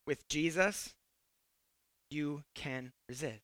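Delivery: very soft, low and deliberate; quiet, low, measured reassurance; hushed assurance. Real, not acted. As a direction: drop right down, pausing between phrases. Hushed line, then repeated firmly.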